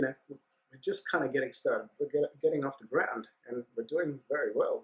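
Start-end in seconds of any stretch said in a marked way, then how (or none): none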